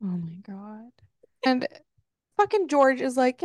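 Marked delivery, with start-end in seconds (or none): none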